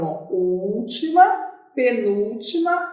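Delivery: ordinary talk, nothing marked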